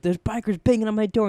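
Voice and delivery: high, loud alarmed report; retold panic. Boy phones his friend about bikers, retold panic. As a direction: act out an urgent call for help.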